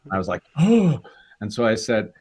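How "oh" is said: high, loud "Oh!" of delight; mock excited gasp. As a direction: act out a sudden thrilled gasp. Reacts to getting the part.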